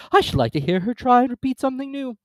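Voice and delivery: high, loud creature voice; Mock Turtle voice. A narrator's reading.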